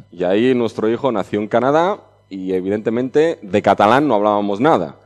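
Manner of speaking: usual speaking voice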